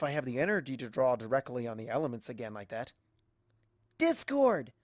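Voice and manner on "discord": high, sharp call of a name; shout. Acted. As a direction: shout out in alarm. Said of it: "I shout".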